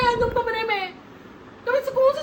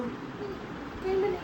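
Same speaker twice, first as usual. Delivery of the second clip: low evasive mumble; 5 semitones lower, 11 dB quieter, much slower than usual. Acted. Wife sneaks away with cigarettes.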